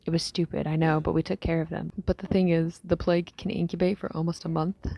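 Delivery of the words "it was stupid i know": ordinary delivery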